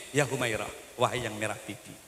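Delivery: whisper-close endearment; tender address. Retold or imitated. The Prophet calling his wife, soft and loving. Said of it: "Nabi kalau memanggil istrinya".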